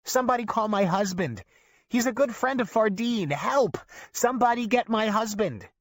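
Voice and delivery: high, pleading calls for her husband; old woman yelling for her husband; frantic yelling. A narrator's reading. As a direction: shout over the crowd for help.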